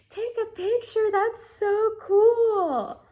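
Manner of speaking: very high and loud, slowing at the end; giddy excitement. Retold or imitated. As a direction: gush at him, thrilled. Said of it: "to Zach, I'm like".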